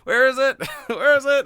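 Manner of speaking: shrill exasperated yell, laughing through it; frustrated yelling. Retold or imitated. Player yelling at the map for the lost spider bot, frustrated.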